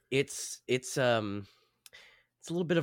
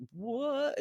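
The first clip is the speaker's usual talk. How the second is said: high, quiet, baffled squeak; high startled what; 13 semitones higher, 5 dB quieter than usual. Retold or imitated. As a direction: squeak it out confused. Gunman quoted, high.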